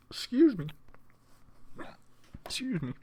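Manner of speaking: apologising between hiccups; jumpy hiccup squeak. Real, not acted. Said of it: "Got the hiccups".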